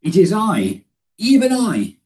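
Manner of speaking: even I climbs very high and loud, stressing I; emphatic I. Shown as a demonstration. Preacher proclaiming God's words to congregation, soaring emphasis. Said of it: "He says twice".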